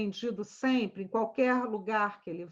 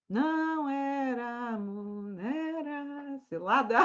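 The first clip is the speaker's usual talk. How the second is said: slow punchline; slower than usual. Shown as a demonstration. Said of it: "punchline said slowly".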